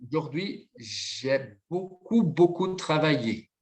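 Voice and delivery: very slow, stretched correction; deliberate teacher's demonstration, lingering on the words; slow modelling. Shown as a demonstration. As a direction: draw out each word for the learner to copy. Teacher models corrected word order.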